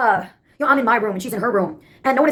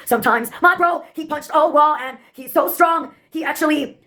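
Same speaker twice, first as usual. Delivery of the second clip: usual speaking voice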